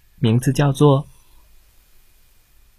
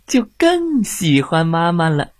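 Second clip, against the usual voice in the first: ordinary delivery, nothing marked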